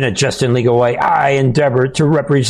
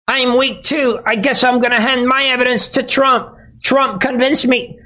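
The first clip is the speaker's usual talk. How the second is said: much higher, whiny fake-tearful voice; mock weepy lawyer; 10 semitones higher than usual. Host imagining Chesebro caving too, whiny high mock sob. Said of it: "Giroudi could at the last minute say".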